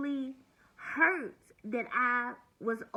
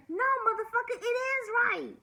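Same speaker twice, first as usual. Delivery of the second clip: higher, louder snap back; fierce rebuttal; 12 semitones higher, 4 dB louder than usual. Real, not acted. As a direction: fire it right back at him. Fiery rebuttal of his claim.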